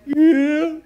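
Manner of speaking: put-on high, loud slurred drunk reply; drunk man. Drunk man answering the preacher, loud and slurred. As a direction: blurt it like a tipsy stranger.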